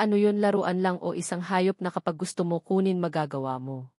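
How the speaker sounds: ordinary delivery, nothing marked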